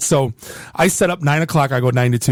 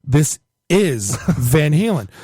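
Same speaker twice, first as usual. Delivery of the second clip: slow, stressed IS, with a pause before "Van Halen"; pointed retort; slower than usual. Retold or imitated. DJ answering a customer who demands the song already playing, pointed retort. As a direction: spell it out slowly, leaning hard on IS.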